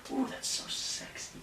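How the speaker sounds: hushed, swooning whisper; whispered aside. Real, not acted. Host swoons over the lyrics in a whisper. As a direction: whisper it under your breath.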